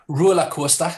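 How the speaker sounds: "roller coaster" with stretched Geordie o sounds, open a endings; Geordie double vowels. Shown as a demonstration. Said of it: "It's a double vowels".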